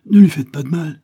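high, loud desperate cry; anguished mother crying out; pleading cry. A narrator's reading. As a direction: cry out to protect the child. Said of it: "sollicitait Isabelle".

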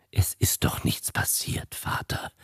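soft, voiceless reassurance; calm the old man in a hush; soothing whisper. A narrator's reading. Son soothes his frightened father in a whisper. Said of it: "beschwichtigte der junge Povondra".

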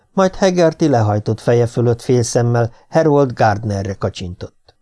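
plain reading voice

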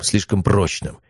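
ordinary delivery, nothing marked

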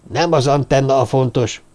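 plain reading voice

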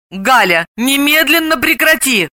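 mildly angry scolding. A narrator's reading. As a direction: tell off a friend. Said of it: "рассердилась Далила".

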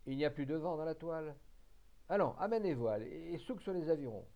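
loud skipper's command; rising loud captain's orders; ordering the crew. Acted. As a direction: bark the order across the boat.

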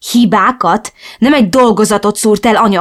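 hisztérikus üvöltés; hysterical yelling. Acted. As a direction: yell it, losing control. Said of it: "üvöltöttem hisztérikusan".

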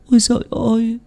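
very high wailing plea, close to crying; near tears. A narrator's reading. Thắm begs the seer to save her family, high tearful wail. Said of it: "nói như sắp khóc".

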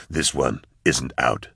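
low, slow and soft; grim retort. A narrator's reading. Gunman aiming at his enemy, low slow menace. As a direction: deliver it low and unhurried, a quiet threat. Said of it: "said Nail".

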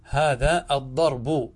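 slow "الضرب" said ad-darbu, ending on u; original case ending. Shown as a demonstration. Teacher slowly repeats the original, "الضرب" said ad-darbu. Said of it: "هذا أصله".

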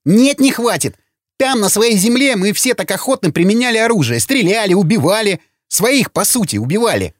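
high-pitched, agitated argument; heated tirade. Acted. Hothead refuses to stop arguing. (запальчивая тирада высоким голосом)

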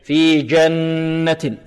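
جنة with the noon doubled and held in a long hum. Shown as a demonstration. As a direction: slowly hold the nn of جنة. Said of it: "النون هنا بارك الله فيكم مشددة".

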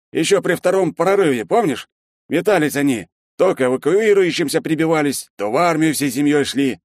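booming Shchedrin. A narrator's reading. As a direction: wave it off with bluster.

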